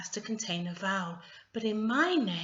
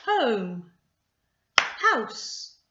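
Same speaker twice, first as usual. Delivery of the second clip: HOME and HOUSE loud, one beat apiece; one sound each; 3 semitones higher, 7 dB louder than usual. Shown as a demonstration. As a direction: punch HOME, pause, then HOUSE, each one syllable. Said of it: "One sound for each of those words".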